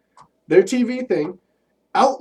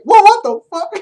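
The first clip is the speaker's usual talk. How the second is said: shocked outburst; 14 semitones higher, 8 dB louder than usual. Pretend. Imagined reaction to being whipped.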